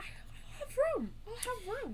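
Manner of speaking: high squeaky voice. Real, not acted. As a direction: squeak it out happily. Happy about storage.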